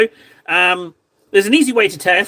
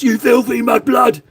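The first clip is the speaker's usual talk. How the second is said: higher shouted mock villain line; imitating Bellatrix shout; 5 semitones higher than usual. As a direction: sneer it like the film witch. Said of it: "she shouts".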